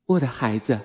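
ordinary delivery, nothing marked

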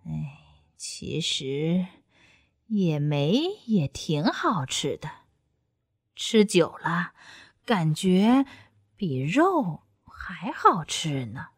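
low, slow, soft voice to himself; fox musing. A narrator's reading. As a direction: muse half-whispered like a content old fox. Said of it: "一边自言自语".

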